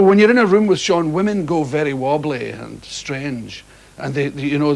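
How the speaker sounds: ordinary talk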